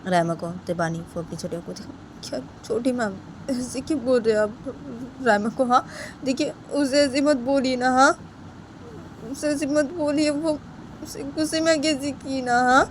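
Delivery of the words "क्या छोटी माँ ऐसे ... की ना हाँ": put-on pleading child Bani. (धीमी, मासूम मिन्नत)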